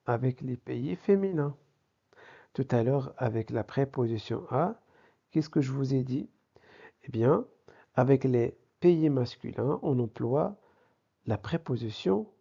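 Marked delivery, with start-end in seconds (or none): none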